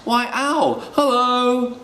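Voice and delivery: high-pitched, sing-out mouse hello; high little mouse voice calling out; cheery mouse greeting. Acted. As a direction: greet an owl in a squeaky high voice. Mouse greets owl in story.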